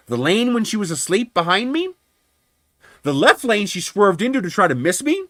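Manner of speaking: high, incredulous rhetorical questions; retold angry interrogation. Driver challenging adjuster about the lanes, pissed off. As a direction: fire each question upward in mounting outrage. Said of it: "I was pissed off to no end".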